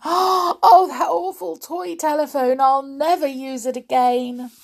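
high, tearful lament; acted sobbing. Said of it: "sobbed Amelia Jane".

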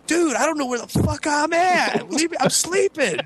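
high, slow mock yell at a stranger; hammed-up sleepy outburst, pitched high; mock scream. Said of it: "I'm, like, screaming at this guy".

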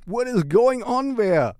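playful over-the-top astonishment; mock surprise. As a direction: pretend to be startled by the bunny.

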